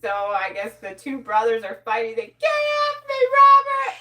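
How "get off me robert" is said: high-pitched loud shout; bird shouting. Retold or imitated. Parrot replaying a brother fight, shrill shout. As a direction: scream it like a kid being pinned down. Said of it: "He had this whole fight going on".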